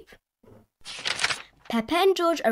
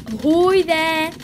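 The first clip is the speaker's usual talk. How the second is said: loud, higher, drawn-out call; hearty sailor's hail; 6 semitones higher, 7 dB louder, slower than usual. A narrator's reading. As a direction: hail them from the boat. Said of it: "Grandpa Pig said".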